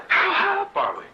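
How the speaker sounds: high, loud squeaky frog asking; a small frog peering out, loud and high. Retold or imitated. McCabe's joke to the company men, frog's high, loud question. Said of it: "he says, uh".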